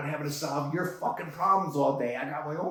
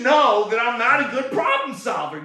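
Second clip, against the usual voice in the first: high, loud furious yell; angry outburst; 8 semitones higher, 6 dB louder than usual. Acted. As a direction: blow up at the interviewer. Ranting at interviewers.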